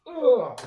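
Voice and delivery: a sharp, high, loud groan of annoyance; frustrated groan. Real, not acted. Builder groaning after forgetting the little clips. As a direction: groan at your own mistake.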